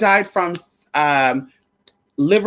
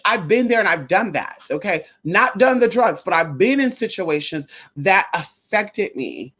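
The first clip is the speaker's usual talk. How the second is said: ordinary talk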